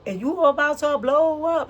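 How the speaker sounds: high, loud hype call; hyped announcement. Pretend. Tarot reader hyping up her viewers, excited. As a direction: pump them up, big and bright.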